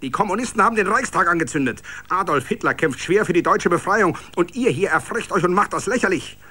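fast, loud, high harangue; harsh lecture. A narrator's reading. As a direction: rattle it off with contempt. SA leader lectures the village.

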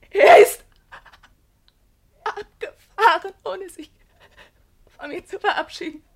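crying. Acted. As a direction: voice breaking into tears. Pauline breaks down.

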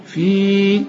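"في" with a sustained long ee; prolonged stop. Shown as a demonstration. Teacher showing how to stop on the word, prolonged stop. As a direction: hold the vowel of "في" long. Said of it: "لكن عند الوقف تقول إيه".

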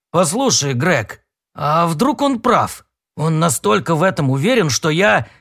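ordinary delivery

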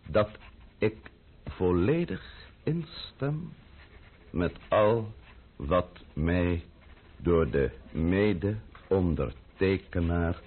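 slow, word by word, deliberate; slow dictation. Acted. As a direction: dictate each word for him to write. Dictating a formal declaration.

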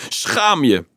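mild indignant yell; scolding yell. A narrator's reading. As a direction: scold a crowd of children sharply. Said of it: "gilde tegen de kinderen". (verontwaardigd uitgeroepen verwijt)

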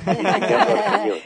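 amused laugh while telling it. Real, not acted. Recalls being told he speaks argeñol. (riendo divertido)

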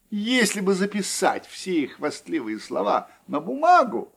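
ordinary delivery

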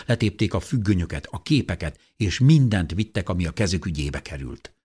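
ordinary delivery, nothing marked

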